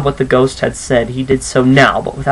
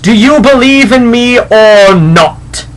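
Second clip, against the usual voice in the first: higher, louder, slow spectral challenge; demanding ghost; 9 semitones higher, 7 dB louder, slower than usual. Acted. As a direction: loom over him and demand it.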